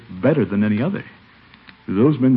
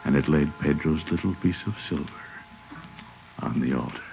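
gentle storybook reading; story narration; 3 semitones lower than usual. Acted. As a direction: read it like a bedtime story. Reads a story aloud.

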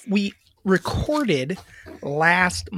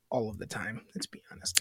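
whispered aside; 10 semitones lower, 16 dB quieter than usual. Real, not acted. Self-deprecating aside.

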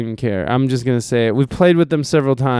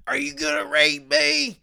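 high-pitched impression of an accusing old street woman; imitating a street lady; 11 semitones higher, 5 dB quieter than usual. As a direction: squeak it up high like the haggard alley lady. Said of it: "she starts yelling".

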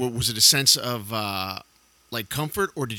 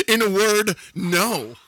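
louder and higher, still laughing; 8 semitones higher, 7 dB louder, slower than usual. Real, not acted. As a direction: say it through the laughter. Host joking after laughing.

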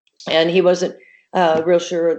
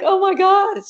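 much higher, startled exclamation; mock shock at seeing the dog; retold shocked gasp; 13 semitones higher than usual. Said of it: "so I was like".

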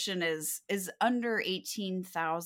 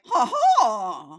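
loud, high-pitched "ha ha"; mock French laugh; 5 semitones higher, 15 dB louder than usual. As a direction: belt out a hammed-up French guffaw. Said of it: "'cause it's French".

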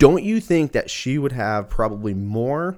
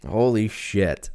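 shocked exclamation; much slower than usual. Retold or imitated. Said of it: "I was like".

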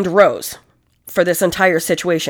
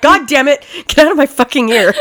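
higher, louder, irritated outburst; annoyed yell; 7 semitones higher, 5 dB louder, faster than usual. Retold or imitated. Spencer pushing away the snake in his ear, annoyed. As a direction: snap back, half laughing. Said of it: "And he's like".

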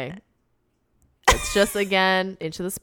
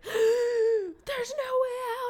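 mock panic; 5 dB louder than usual. Zed trapped at the tunnel bottom, high mock panic. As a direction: wail like you're trapped.

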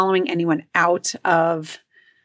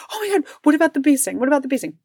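imitating panicked kid; 8 semitones higher, much faster than usual. Son panicking about bee sting.

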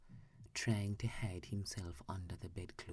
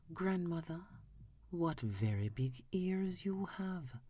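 put-on a small girl marvelling at grandmother; little girl surprised; 9 semitones higher than usual. A narrator's reading.

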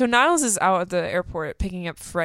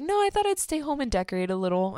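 mock innocent Martin; 6 semitones higher, faster than usual. Martin voiced, high and fast.

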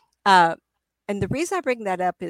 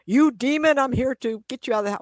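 mock yell; 6 semitones higher, 4 dB louder than usual. Mocking a yelling investigator.

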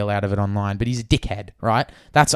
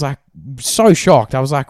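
ordinary talk, nothing marked

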